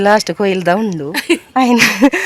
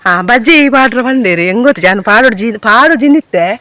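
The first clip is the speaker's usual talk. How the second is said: excited telling; 4 semitones higher, 8 dB louder than usual. Real, not acted. Eager telling about bajji.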